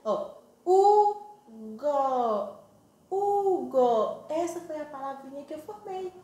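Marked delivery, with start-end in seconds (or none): 0.6-4.2 very slow, each syllable held and separate. Shown as a demonstration. Teacher joining two syllables for children, Hugo slowly sounded out. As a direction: stretch Hugo into a long U, then go. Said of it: "quando eu junto elas duas".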